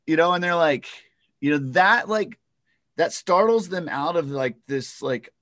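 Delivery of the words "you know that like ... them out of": usual speaking voice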